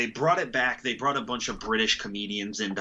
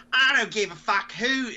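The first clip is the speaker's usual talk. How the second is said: put-on higher, brash British accent; 10 semitones higher than usual. A nasty reality-show contestant boasting, in a British accent. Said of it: "I'm putting it back British".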